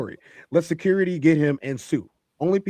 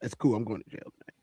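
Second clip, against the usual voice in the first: softer, lower, deadpan calm menace; cool calm threat; 5 semitones lower, 8 dB quieter than usual. Retold or imitated. Quoting a fighter's calm words to the panel, softly deadpan. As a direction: say it like you've already decided. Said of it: "The emphasis on the words that was used was".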